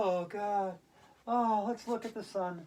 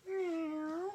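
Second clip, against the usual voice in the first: much higher cat meow; 10 semitones higher, 8 dB quieter than usual. Acted. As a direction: mew like a cat. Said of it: "cat and cow".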